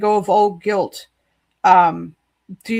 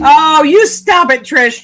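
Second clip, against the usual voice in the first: playful scold; 6 semitones higher, 9 dB louder, slower than usual. Real, not acted. Teasing friend in chat.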